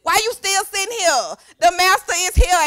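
loud, high pep talk to yourself; urgent self-scolding. Acted. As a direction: get yourself moving, forcefully. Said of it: "talk to yourself like that".

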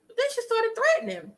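high dramatic. Shown as a demonstration. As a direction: fire it off high and quick. Dramatic retelling, repeated line.

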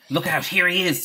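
quick alert, voice lifted; sudden warning. A narrator's reading. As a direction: call out a sudden heads-up. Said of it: "said Peter suddenly".

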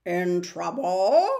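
slow, strange spider voice with concern; a touch of worry from an old spider. Acted. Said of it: "heard concern".

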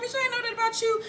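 high-pitched mock surprise; surprised. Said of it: "Holly is surprised by this".

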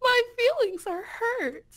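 high, loud, hammed-up wounded whimper; mock hurt. Guest teases the host with mock wounded crying. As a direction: play the crybaby for a laugh.

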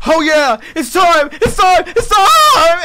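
loud, building hype chant; hyped exclamation. Retold or imitated. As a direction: shout it like it's go time. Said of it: "he was like".

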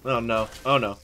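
ordinary delivery

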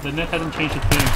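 ordinary talk

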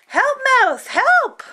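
high, loud call; calling for help. Acted. Cat calling the mouse for help, high and loud.

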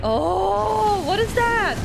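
loud, high gasp of amazement; amazed. Real, not acted. Player spotting something new in the game, loudly amazed. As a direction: exclaim it high and loud in surprise.

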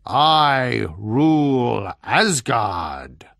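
very slow, loud giant boom. A narrator's reading. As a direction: make the words roll like thunder. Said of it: "boomed the giant".

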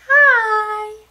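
high, loud little voice; high squeaky hi. Acted. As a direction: squeak out a tiny hi. Voicing the reply to hi.

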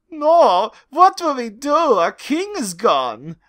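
weeping. Acted. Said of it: "wept the English soldiers in sorrow".

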